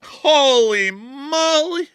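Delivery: drawn-out astonished exclamation; slow, high, amazed holy moly. Real, not acted. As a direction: stretch it out in awe. Stunned at drone clip.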